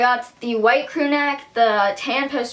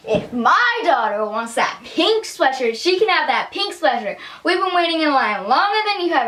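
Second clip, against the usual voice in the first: high, indignant mock scolding; imitated mom yelling; 5 semitones higher than usual. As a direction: play an angry mom laying down the law. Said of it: "that mom just yelled at me".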